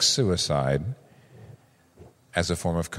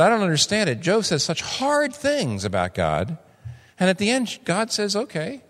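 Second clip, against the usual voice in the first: baffled student; 9 semitones higher than usual. Retold or imitated. Student objecting in class.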